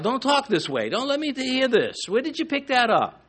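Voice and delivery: mock scolding mother. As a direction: scold like a mom catching her kid's bad language. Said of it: "like a mother sort of, uh, rebuking her child".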